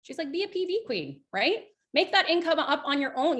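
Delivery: upbeat upline. Retold or imitated. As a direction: sell it like a cheerleading mentor. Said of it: "She goes".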